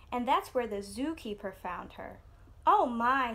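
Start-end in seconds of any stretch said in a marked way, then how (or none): 2.6-3.3 louder, startled "oh my"; a zookeeper's shocked exclamation; dismayed cry. A narrator's reading. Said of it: "he cried".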